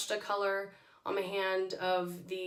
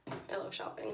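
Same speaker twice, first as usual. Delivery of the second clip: whisper; 8 dB quieter than usual. Real, not acted. Whispered aside about shopping.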